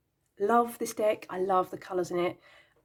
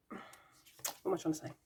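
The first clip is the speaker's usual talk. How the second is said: flustered whisper to herself; 10 dB quieter, much faster than usual. Real, not acted. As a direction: whisper it, lost and muddled.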